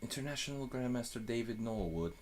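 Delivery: British-accent introduction. Shown as a demonstration. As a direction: announce him in a British accent. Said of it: "Can you do a British accent?".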